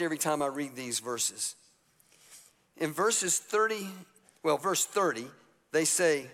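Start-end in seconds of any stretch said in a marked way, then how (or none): none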